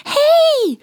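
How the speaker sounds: very high, loud protesting cry; squeaky shout. Acted. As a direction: yell from inside the backpack. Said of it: "ruft es da schon aus dem Rucksack". (sehr hoher, lauter Protestruf)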